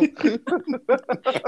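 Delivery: hysterical laugh. Real, not acted. Riso após "que drama.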